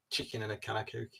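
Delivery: lightly attempted Scouse; a hint of Scouse on the phrase; Scouse accent. Shown as a demonstration. Quoting an actor's tip for a Scouse accent, lightly Scouse. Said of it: "to get a good Scouse accent".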